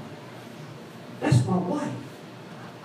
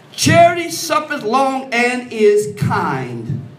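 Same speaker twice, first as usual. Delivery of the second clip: preached scripture; 7 dB louder, much slower than usual. Acted.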